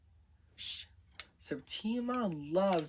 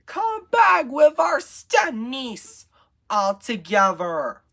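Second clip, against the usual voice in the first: calling out; 10 semitones higher, 12 dB louder than usual. A narrator's reading. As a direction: holler after them, drawing the words out. Stepsisters calling out after Septima's finders.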